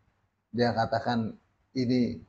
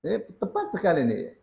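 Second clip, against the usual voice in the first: ordinary talk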